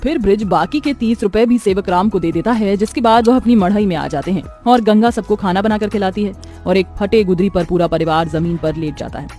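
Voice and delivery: plain reading voice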